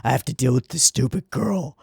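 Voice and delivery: mock gruff grudging complaint; gruff reluctance. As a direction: huff it out as a put-upon grouch. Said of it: "gruff and kinda like".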